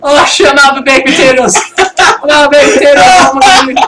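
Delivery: high, loud Scottish wail; mock despair in a Scottish accent. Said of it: "your Scottish accent just".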